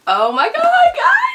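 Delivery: high, loud squeal of delight; thrilled shriek at the mail; excited squeal. Real, not acted. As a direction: squeal over the package. Excited reaction to book mail.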